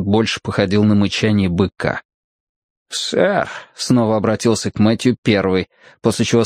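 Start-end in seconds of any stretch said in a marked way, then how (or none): 2.9-3.5 higher-pitched tipsy gentleman; lightly voiced drunk man; mumbling drunk. A narrator's reading. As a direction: address a stranger in a drunken mumble. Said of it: "невнятно пробормотал один из мужчин".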